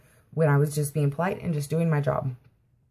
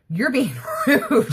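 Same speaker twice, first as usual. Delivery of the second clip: higher, louder, slow laughing accusation; mocking laugh; 8 semitones higher, 5 dB louder, slower than usual. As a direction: laugh through the word rude in disbelief. Rep accusing caller of rudeness.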